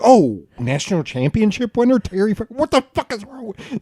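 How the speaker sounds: high-pitched retold freak-out; rising, disbelieving mock outburst. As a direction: act out a starstruck panic. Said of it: "I was freaking out later".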